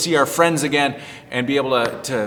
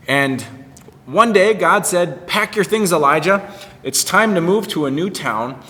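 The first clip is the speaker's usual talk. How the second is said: put-on reading to children; 2 semitones higher than usual. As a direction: tell the Bible story to kids with bright expression. Children's story read aloud.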